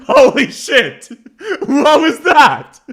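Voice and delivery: loud, high, slow, laughing; real laughing disbelief. Real, not acted. Streamer stunned by a voice line, loud and laughing. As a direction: crack up in disbelief. Cracking up at a clip.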